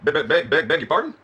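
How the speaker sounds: played-up Southern stutter; flustered stammer. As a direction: trip repeatedly over the opening word. Acted flustered stammer at being recognised.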